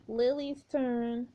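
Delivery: slow eerie whisper of a ghost; drawn out, haunting; creepy slow ghost voice. Acted. [name]'s creepy voice.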